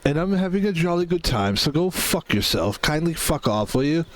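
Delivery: put-on jokey British accent, kindly swearing; mock posh British. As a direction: play a polite Englishman telling you off. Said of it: "That's how they really talk".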